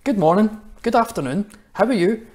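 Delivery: cheery greeting. Shown as a demonstration. Children's pastor demonstrating a cheery hello to strangers. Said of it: "walk around and say".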